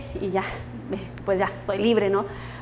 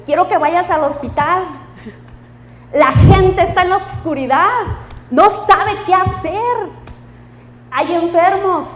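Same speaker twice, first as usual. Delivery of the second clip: fervent call; 5 semitones higher, 6 dB louder than usual. Acted. Voices God's call, rising fervor.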